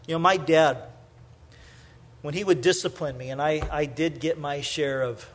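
ordinary talk

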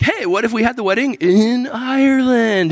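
high, excited mock suggestion; hammed-up sitcom voice; chirpy TV character. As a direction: pitch the idea with a big lift. Voicing a TV show character.

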